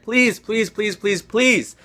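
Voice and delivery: begging over and over, fading; desperate little prayer; pleading. Real, not acted. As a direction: plead with the fantasy gods. Begging the fantasy gods.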